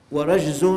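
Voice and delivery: ورجز with an a after the ر: wa-rajzun; fatha on first letter. Shown as a demonstration. Preacher showing listeners the word's three vowellings, ورجز with fatha, "wa-rajzun". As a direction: say ورجز loudly as "wa-rajzun", short a. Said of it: "بالكسر والفتح والضم".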